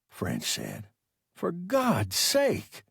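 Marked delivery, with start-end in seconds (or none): 1.3-2.8 slow, drawn-out mild exclamation; exclaiming. Acted. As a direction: Peterson protesting the order to shoot, slow and drawn out. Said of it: "Peterson exclaimed".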